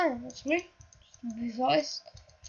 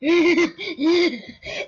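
higher-pitched imitation of someone's laugh; mocking laugh; 4 semitones higher than usual. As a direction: mimic their laugh to tease them. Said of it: "That's what you sound like".

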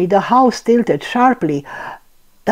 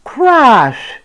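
loud; 6 semitones higher, 5 dB louder than usual. A narrator's reading. Crash sound in story.

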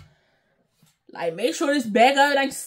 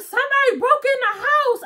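higher, frantic retelling of the scream; mock panicked cry; 8 semitones higher than usual. Friend on the phone after the break-in, mock panicked and higher. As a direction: lift it up, imitating her panic. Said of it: "she called me back, screaming, crying".

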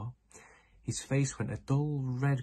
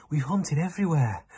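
out of breath; 7 semitones higher, 9 dB louder than usual. Acted. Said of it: "panted Jazz".

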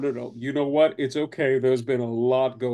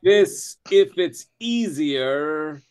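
higher, slow, careful creature voice; above-water voice; 5 semitones higher, slower than usual. Acted. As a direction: try hard to sound like an air-breather. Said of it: "his, like, above water voice".

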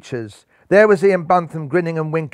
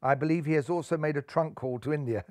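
much softer, quick, lightly voiced Ellie; smiling; 2 semitones lower, 11 dB quieter than usual. A narrator's reading. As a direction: report the news softly with a smile. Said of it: "smiled Ellie".

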